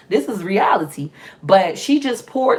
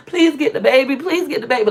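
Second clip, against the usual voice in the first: higher-pitched pleading, only lightly tearful; retold tearful plea; 6 semitones higher than usual. Mom phoning daddy to take the baby, retold pleading. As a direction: re-enact a desperate, crying plea without real sobs. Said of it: "Like, boo-hoo crying".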